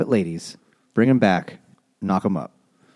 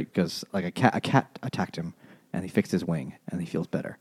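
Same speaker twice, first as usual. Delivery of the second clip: usual speaking voice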